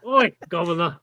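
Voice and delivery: hammed-up English "Oi, governor"; badly faked English street greeting; mock English accent. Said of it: "in English accent".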